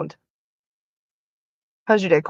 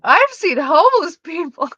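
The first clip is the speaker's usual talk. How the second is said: higher, louder mock declaration; amused mock quoting; 6 semitones higher, 6 dB louder than usual. Repeating the viewer's statement once more, amused mock quoting. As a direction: quote it big, on the edge of laughter. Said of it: "that's just the funniest statement".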